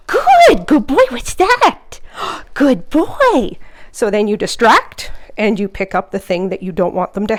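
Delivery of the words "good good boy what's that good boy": high, loud gasping praise; excited puppy praise. Real, not acted. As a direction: gasp and gush. Excited praise after leave it.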